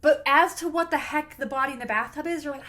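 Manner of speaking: ordinary talk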